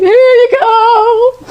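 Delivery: high, slow tearful squeak; crying. Retold or imitated. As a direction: hand it over through sobs. Sobbing while handing over license.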